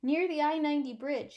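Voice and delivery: ordinary delivery, nothing marked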